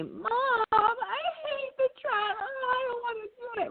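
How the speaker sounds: put-on high, crying kid's whine; whining child. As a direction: stretch it out like a child whining about a chore. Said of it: "And you're whining, that tone of voice".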